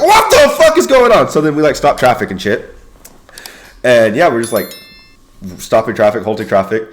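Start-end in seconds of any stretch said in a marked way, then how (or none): 0.0-1.3 high, loud, slow drawn-out disbelief; bewildered yell. Retold or imitated. Troops confused by the shots call, bewildered yelling. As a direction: stretch it into an alarmed shout.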